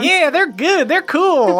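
high-pitched villager approval; high little townsfolk voice; crowd members. Acted. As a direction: answer as scattered voices in a crowd. Crowd answers Moonshine.

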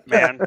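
sudden high laugh; burst of laughter. Real, not acted. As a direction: crack up laughing. Laughing at the naked joke.

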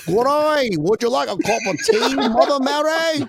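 high, loud, hammed-up British; cartoonish British with an Australian slant; bad British accent. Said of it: "That's the best British accent from a guy from Australia".

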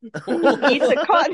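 slow, laughing menace; laughing creepy whisper. Acted. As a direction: drawl it slowly through laughter. Creepy mock threat, laughing.

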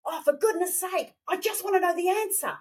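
fed up, demand the answer; a put-on huff of frustration; mock exasperation. Shown as a demonstration. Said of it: "We can ask through anger".

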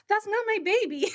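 high, indignant correction; startled protest. Retold or imitated. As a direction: blurt it out in disbelief. Mother finally protests.

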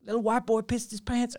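high-pitched jeering imitation; mocking housemates. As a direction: taunt him as the jeering housemates.

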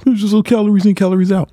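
high-pitched mock know-it-all; mocking fitness guys. Host parroting fitness guys who hate keto, mocking. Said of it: "I know you all hate".

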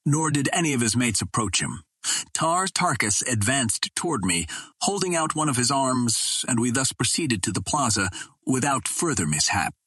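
plain reading voice

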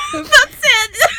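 high, loud squeal through laughter; real helpless laughter. Real, not acted. Ending the bad chat story, shrieking with real laughter. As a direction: squeal it out while cracking up. Cracking up at the text exchange.

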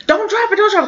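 high, urgent pleading, imitating the friend; panicked warning. Friend begging her not to drop the gun, high panicked plea.

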